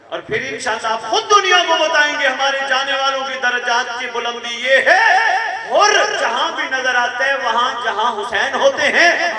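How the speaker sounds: high, loud fervent sermon cry; impassioned preaching. Acted. Preacher crying out to mourners, high and loud. Preacher rises to full force.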